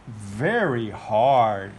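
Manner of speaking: slow emphatic. Acted. Elephant voiced, drawn out.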